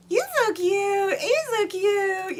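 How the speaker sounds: high, drawn-out cooing at something adorable; mock cooing. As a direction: imitate people gushing over a cute little thing. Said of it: "everybody else is like".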